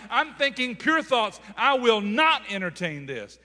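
forceful declaring prayer. Acted. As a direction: build into full-force declarations. Preacher acts out a forceful prayer.

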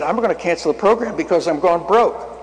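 high-pitched, emphatic retold protest; exasperated retort. Preacher retells answering God about the program, exasperated. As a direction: push it up high as if stating the obvious.

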